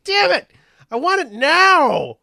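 high, loud frustrated whine; whiny shout. Retold or imitated. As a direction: whine it like a spoiled kid. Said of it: "it's just like".

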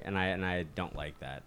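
normal talking voice

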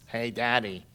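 low little sidekick voice; Disney sidekick voice. Retold or imitated. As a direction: imitate a toddler with a low cartoon sidekick voice. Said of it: "It was a Disney sidekick voice".

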